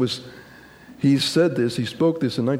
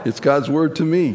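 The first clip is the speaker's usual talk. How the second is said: slow, higher declaration for echoing; congregational declaration; 4 semitones higher, 4 dB louder than usual. Real, not acted. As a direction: proclaim it slowly for the congregation. Leads congregation in repeated declaration.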